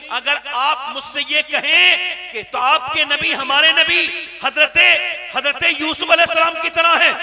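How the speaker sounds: building from firm to full shout; shouted preaching. Acted. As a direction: rise to a roaring climax. (धीरे-धीरे चिल्लाती तक़रीर)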